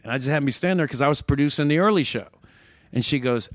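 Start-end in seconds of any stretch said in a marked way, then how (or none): none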